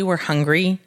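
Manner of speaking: ordinary delivery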